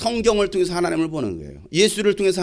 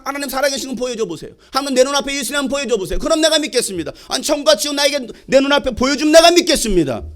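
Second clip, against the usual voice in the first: fast, higher-pitched skeptic's challenge; demanding skeptic; 5 semitones higher than usual. Retold or imitated. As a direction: demand proof in a quick, raised voice. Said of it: "그런 사람이 있을지도 모르겠습니다". (의심하는 사람처럼 높고 빠르게 요구하듯)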